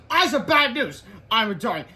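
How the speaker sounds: loud, high announcement to the class; shouting teacher. A narrator's reading. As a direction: announce it forcefully, pitched high.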